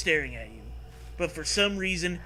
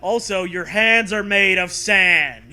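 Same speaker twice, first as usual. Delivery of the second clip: mock dramatic; 4 semitones higher, 11 dB louder than usual.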